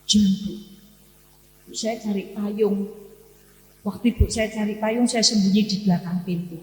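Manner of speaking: ordinary talk, nothing marked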